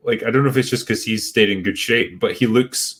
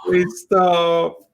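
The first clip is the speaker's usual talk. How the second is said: laughing; 9 semitones higher than usual. Real, not acted. Laughing plea at joke.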